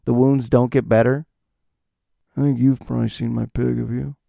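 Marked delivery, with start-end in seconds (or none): 2.4-4.2 Nick Cage. Retold or imitated. Loner questioning someone about his pig, understated impression. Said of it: "No, Nick".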